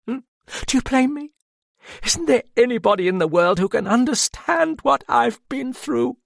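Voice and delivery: a proud woman breaking under shame; a humiliated woman demands that the detective understand her; distraught Madame. A narrator's reading. Madame's voice held.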